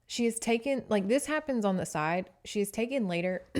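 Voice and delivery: ordinary talk, nothing marked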